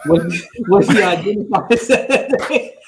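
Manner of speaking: laughing through the question. Real, not acted. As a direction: giggle through it. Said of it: "repeats the question laughing".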